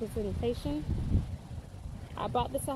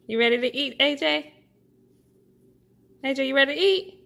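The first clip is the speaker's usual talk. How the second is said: calling out; 9 dB louder, faster than usual. Real, not acted. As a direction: raise the voice to get his attention. Calling child to eat.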